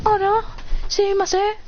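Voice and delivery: high-pitched student voice; flustered apology. Acted. Student apologizing in class, high flustered voice. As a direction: pipe up high in mock dismay.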